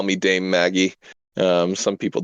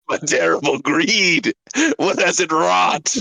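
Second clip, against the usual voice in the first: slow, higher, hammed-up villain lament; 7 semitones higher than usual. Player hamming up her character's villain line, slow and high. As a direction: wring every word like a pulp villain. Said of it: "you have to say that line".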